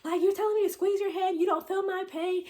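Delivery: loud, very high acted-out rant; retold pained yelling. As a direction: wail and scold as in imitated labor pain. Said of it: "I'm yelling at her".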